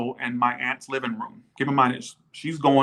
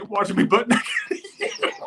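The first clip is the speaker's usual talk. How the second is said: laughing; 7 semitones higher, slower than usual. Real, not acted. Joking about ghost watching shower.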